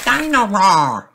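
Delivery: very high, slow, eager call; little boy calling his toy; excited toddler. A narrator's reading. Little boy wants toy in the bath, high eager call.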